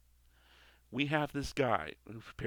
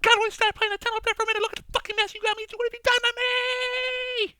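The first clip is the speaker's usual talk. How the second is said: screeching rant, much higher and louder; Sam Kinison scream; 12 dB louder, much faster than usual. Retold or imitated. Kinison's angel screams at the Lord, a wild shriek. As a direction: rage at the sky at full lung. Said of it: "The one and only Sam Kinison".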